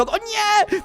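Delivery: high-pitched sudden panic; alarmed cry. Real, not acted. Player sees the wave coming, high alarmed cry. Tsunami appears behind him.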